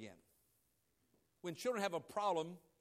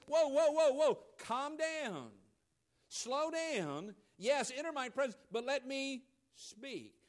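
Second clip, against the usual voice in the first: God calming believer; 5 semitones higher, 6 dB louder, slower than usual. Pretend. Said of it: "And God says".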